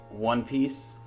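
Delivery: ordinary talk, nothing marked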